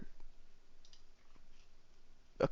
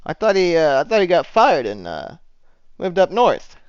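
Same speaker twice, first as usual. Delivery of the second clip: higher, louder, puzzled lieutenant; puzzled objection; 9 semitones higher, 7 dB louder than usual. Acted. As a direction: object in raised-pitch confusion. Lieutenant disputes the accusation.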